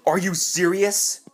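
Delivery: high, loud, drawn-out disbelief; incredulous denial. Retold or imitated. A boy texting back about bad news he won't believe, incredulous. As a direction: play his stunned disbelief, slow and loud. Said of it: "I think he was in denial".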